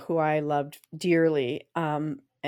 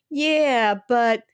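higher, whiny complaint, slow; mopey Debbie Downer; 7 semitones higher, 5 dB louder, slower than usual. Retold or imitated. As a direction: moan about the rain like a killjoy. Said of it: "And she'd go".

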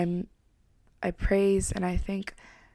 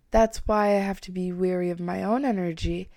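ordinary talk, nothing marked

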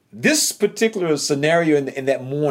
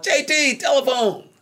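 high called-out summons across a noisy floor; hollering a name; 12 semitones higher, slower than usual. Retold or imitated. Factory worker calling him to the phone, hollered high.

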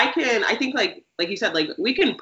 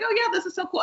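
high-pitched retold excitement; excited reaction; 12 semitones higher, 3 dB quieter, faster than usual. Host recalling her reaction to fan messages, lightly excited. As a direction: voice jumps up, mildly thrilled. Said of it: "I get very excited".

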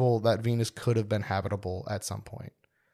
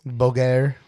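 Bouguer said with a French accent, poo-GAIR. Shown as a demonstration. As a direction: Bouguer in a light French accent, stress on the last syllable. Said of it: "saying Bouguer with a French accent".

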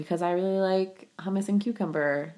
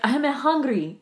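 higher, mocking French accent; mimicked French accent; 5 semitones higher than usual. Teasing the co-host's lingering accent, French accent. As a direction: imitate a stray French accent. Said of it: "can you lose the accent now?".

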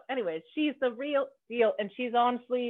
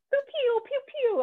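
high, playful pew pew of a gun; gun sound effect. Retold or imitated. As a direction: spit out toy gunshot noises. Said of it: "like Arnold Schwarzenegger with the gun".

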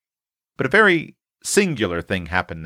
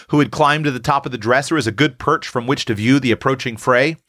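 usual speaking voice

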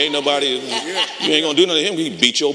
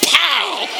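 high, sharp mock punch sound; punch sound effect. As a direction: spit out the punch noise high. Imitating the punch.

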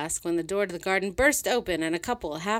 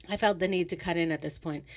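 usual speaking voice

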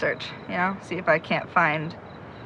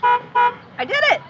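triumphant cry; 3 dB louder, slower than usual. Acted. Thinks she picked the lock.

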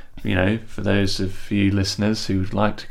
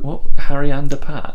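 incredulous; 6 semitones higher, 12 dB louder than usual. Real, not acted. Incredulous repeat of slip.

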